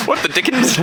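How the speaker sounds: put-on high, laughing old-timey exclamation. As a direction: squeal it while laughing. Laughing, quoting old-fashioned exclamation.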